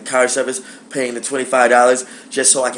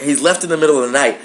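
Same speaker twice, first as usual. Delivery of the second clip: ordinary delivery, nothing marked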